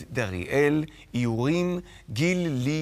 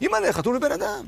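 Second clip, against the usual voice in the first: high, fast mock-terrified squeal; hammed-up frightened shriek; panicked cry; 12 semitones higher, 4 dB louder, faster than usual. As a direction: imitate a tiny bug crying for mum. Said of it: "וקרא".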